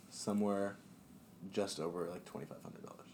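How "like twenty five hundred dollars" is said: hushed, breathy whisper of the price. Real, not acted. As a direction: whisper the amount under your breath. Stating trip cost, then repeats it.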